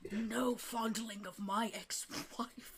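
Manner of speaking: slow decree dropping soft; flustered king. Acted. King banning fondling of his ex-wife, flustered and hushed. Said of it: "he kinda gets very flustered".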